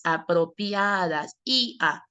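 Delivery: Apropiadas stretched out slowly, the ia drawn long; slow sounding-out. Shown as a demonstration. Teacher showing the diphthong, Apropiadas slowly sounded out. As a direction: draw out the ia in Apropiadas. Word repeated to show diphthong.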